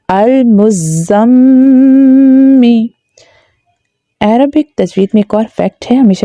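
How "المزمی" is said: المزمی with a long, sustained nasal m; ghunna. Shown as a demonstration. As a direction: hold the nasal hum on the م of المزمی. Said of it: "وہاں پر ہمیں غنہ کرنا ہوتا ہے".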